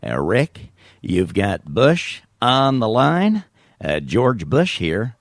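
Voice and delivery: slow, drawn-out George Bush voice; stupid radio-bit Bush voice, dragging the words; George Bush impression. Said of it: "doing stupid voices on his show".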